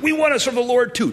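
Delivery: high, quick, eager protest; mock-eager followers insisting; eager crowd. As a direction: chime in keenly as the people. Acts out the eager people answering.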